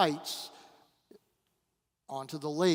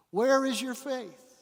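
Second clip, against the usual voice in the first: emphatic repeat; 8 semitones higher, 3 dB louder than usual. Shown as a demonstration. Preacher repeats Jesus' question.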